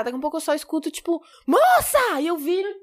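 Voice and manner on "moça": a mock yell at a stranger, pitched high; retold shout. Firefighter shouting up at the girl on the roof, mock yell. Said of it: "gritou, gritou".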